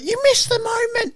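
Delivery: hammed-up disappointed mum; mock mum complaint. Mum complaining the kid missed the moment, high and whiny.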